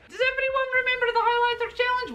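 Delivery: rally the audience; hyped question. Real, not acted. Hyping the challenge.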